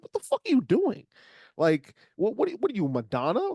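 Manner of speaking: high, fast exasperated disbelief; mocking a friend's new accent; incredulous. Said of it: "and I was like".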